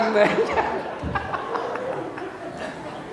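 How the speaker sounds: high breathless unvoiced laugh; airy laugh. Real, not acted. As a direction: laugh silently at the joke. Preacher laughs at own joke. (tawa tanpa suara)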